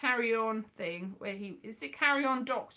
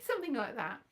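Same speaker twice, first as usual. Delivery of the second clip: ordinary talk, nothing marked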